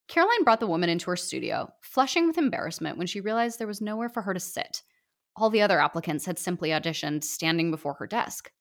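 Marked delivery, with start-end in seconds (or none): none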